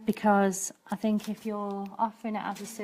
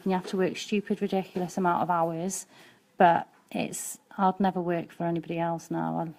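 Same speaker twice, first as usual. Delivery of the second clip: normal talking voice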